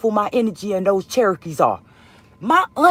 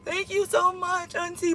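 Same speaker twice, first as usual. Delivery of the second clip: tearful thanks; 8 semitones higher, 5 dB quieter than usual. Retold or imitated. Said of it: "I'm crying hysterically again".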